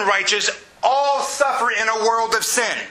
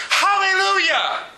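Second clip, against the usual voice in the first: high-pitched, drawn-out cry of praise; imitated heavenly shout; 11 semitones higher than usual. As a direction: cry it out like a host of elders in heaven. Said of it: "the twenty-four elders shouting".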